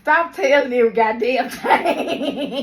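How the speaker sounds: loud, high scolding dad. Retold or imitated. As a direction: bark it like an annoyed father. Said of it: "my dad be like".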